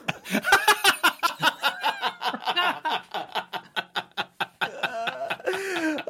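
helpless high-pitched laughter at own joke; long high laugh. Real, not acted.

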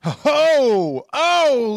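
loud, high whooping cheer; drawn-out delighted holler; real excited whoop. Real, not acted. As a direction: whoop at a lucky dice roll. Celebrating a natural twenty.

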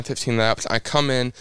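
ordinary talk

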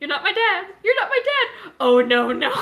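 loud, high, laughing mock anguish; melodramatic yell. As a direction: cry out in hammed-up despair. Acting melodramatic denial.

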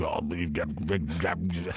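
low, quiet mumbling rival; mocking rival. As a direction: mutter it like a careless rival rehearsing. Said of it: "he's practicing the line".

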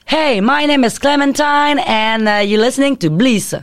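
English podcast intro. Pretend. Co-host pitches an English edition to the host, mock intro. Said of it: "quand est-ce qu'on a".

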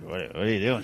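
low, muttered star impression; imitating a gruff movie star, low; Harrison Ford. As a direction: drop the pitch and grumble it softly. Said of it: "Harrison's like".

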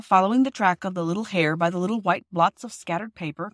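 plain reading voice

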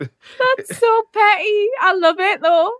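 slow, high amused delight. Real, not acted. Guest delighted by the host's petty trick, amused. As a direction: drag it out, tickled. Guest reacts to the bath plug story.

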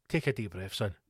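low, soft self-soothing; calming himself. Real, not acted. Angry ranter tells himself to breathe, calming himself. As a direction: talk yourself down, low and quiet. I'm getting angry, Gibbo.

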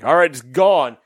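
loud impatient mock command; loud impatient push. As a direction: wave them along loudly. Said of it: "You're like".

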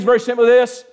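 ordinary talk, nothing marked

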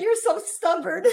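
mild retold yell between laughs. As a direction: scold fondly, loud and high. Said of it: "I yelled at him that morning".

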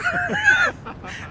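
very high squealing laugh. Real, not acted. Teller amused by the cop's insult, high laugh. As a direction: break into a high-pitched laugh.

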